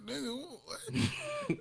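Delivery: incredulous. Retold or imitated. Retelling a drive-thru worker ordering for him, squealing disbelief. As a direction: drag it out in shocked disbelief. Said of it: "I'm like".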